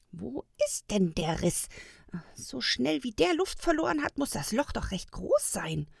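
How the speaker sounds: mild puzzled grumble; grumbling to himself. A narrator's reading. Old elf to himself, searching a flat tyre, grumbling. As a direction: grumble lightly under the task at hand. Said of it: "brummte er vor sich hin".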